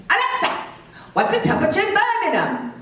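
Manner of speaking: very high, insistent mock Cockney; thick Cockney accent. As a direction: repeat it insistently in exaggerated Cockney. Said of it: "he's got that thick Cockney accent".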